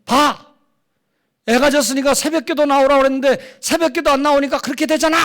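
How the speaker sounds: loud, high, fast scolding rant; imitated angry yell. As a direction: yell it like a pastor berating someone. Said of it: "이렇게 소리를 빽 지르더라는 거예요".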